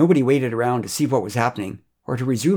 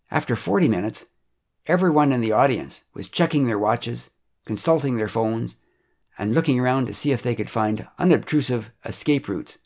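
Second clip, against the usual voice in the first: plain reading voice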